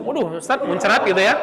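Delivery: quick laughing imitation of a complaining student; mock student, laughing. Teacher playfully imitating a student complaining, laughing. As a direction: tease like a student. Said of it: "ngajarin ke murid".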